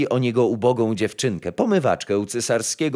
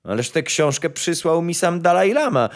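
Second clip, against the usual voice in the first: higher-pitched imperial protest; emperor's exclamation; 7 semitones higher, 3 dB louder than usual. A narrator's reading. Emperor protesting to his court about the book, exclaiming. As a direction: exclaim as an indignant ruler, lightly acted. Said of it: "zawołał cesarz".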